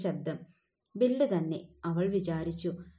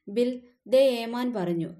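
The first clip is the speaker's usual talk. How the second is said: loud, slow call to Bill; hammed-up call passing on the boss's order; 4 semitones higher, 5 dB louder than usual. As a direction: shout it across the yard, drawn out. Calling out to Bill.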